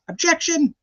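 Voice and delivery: high, sharp Southern-flavoured "Objection"; Diener impression. Imitating lawyer Diener objecting in court, high Southern twang. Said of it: "That was my Diener impression".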